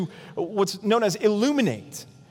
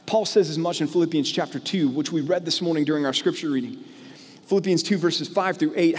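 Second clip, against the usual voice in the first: usual speaking voice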